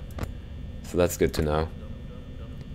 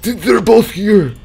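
high, loud and slow frightened cry; real fright at seeing them both; alarmed shout; 12 semitones higher, 8 dB louder, slower than usual. Real, not acted. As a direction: blurt it in sudden real fright. Both animatronics suddenly appear.

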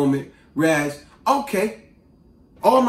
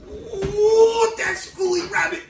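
high cartoon fuming, long opening howl; Yosemite Sam; 13 semitones higher, much slower than usual. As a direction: seethe like a cartoon villain outwitted. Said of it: "Like Yosemite Sam for Bugs Bunny".

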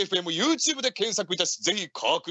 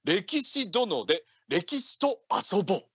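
radio announcer; 4 semitones higher, 4 dB louder than usual. Acted. Radio show jingle call.

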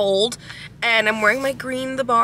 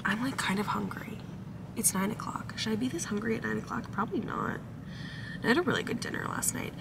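whispering; 12 dB quieter than usual. Real, not acted. Hushed talk to camera.

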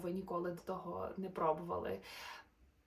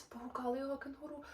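lively, sing-song storybook narration; animated bedtime-story voice; reading to children; 5 semitones higher, 4 dB louder than usual. A narrator's reading. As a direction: read the rhymes brightly to little listeners. Reads a rhyming children's story.

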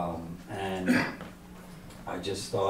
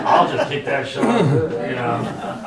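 laughing through the words, a little louder; 4 semitones higher, 9 dB louder, slower than usual. Retold or imitated. He laughs, retelling his urge to kick the shark. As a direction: tell it while cracking up at the idea.

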